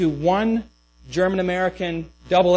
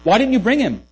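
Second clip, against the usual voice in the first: higher, louder challenge; demanding Pharisees; 7 semitones higher, 7 dB louder than usual. Retold or imitated. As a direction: put it as an indignant demand to underlings. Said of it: "the Pharisees say to them".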